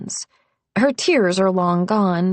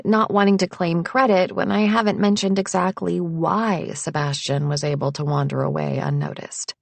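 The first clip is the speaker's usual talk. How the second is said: usual speaking voice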